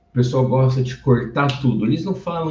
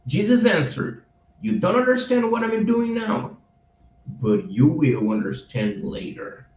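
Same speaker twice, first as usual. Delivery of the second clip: verse reading; 5 semitones higher than usual. Shown as a demonstration. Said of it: "teacher reads the full verse".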